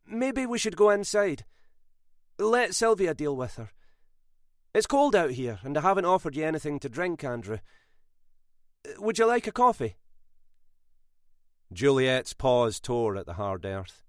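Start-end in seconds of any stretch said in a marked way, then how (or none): 0.0-10.0 high, frantic hostess voice; hurried and forced. A narrator's reading. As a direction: rush him inside with false cheer. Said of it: "hurried, frantic and forced".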